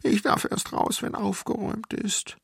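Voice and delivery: put-on high, whimpering child's complaint; acted tearful little-kid voice; sobbing child. A narrator's reading. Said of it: "schluchzte ich".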